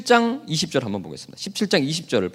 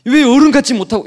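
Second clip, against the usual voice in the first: stern parent; 7 semitones higher, 9 dB louder than usual. Retold or imitated. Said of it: "이렇게 하지 않아요".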